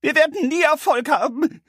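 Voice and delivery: very high piercing sob voice; cartoon crybaby. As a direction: wail it like a scared crybaby. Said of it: "schluchzte der Angsthase mit hoher und durchdringender Stimme".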